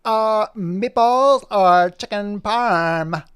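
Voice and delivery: high, loud, very slow question; Poppy voice. Acted. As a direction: stretch it out like a pondering pup. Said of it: "And Poppy goes".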